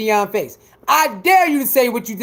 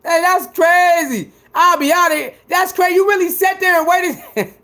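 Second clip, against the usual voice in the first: normal talking voice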